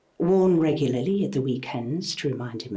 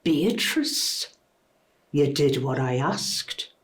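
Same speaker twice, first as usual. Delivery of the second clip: name said like an olive pit in the mouth; understated, wounded disdain; pained distaste; slower than usual. Acted. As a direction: imitate the husband's controlled distaste, without shouting. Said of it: "He'd say my name like it was a Kalamata olive pit lacerating".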